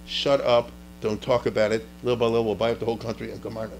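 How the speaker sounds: low, lightly voiced hush-it-up financier; Rothschild. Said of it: "Rothschild came from a very different point of view".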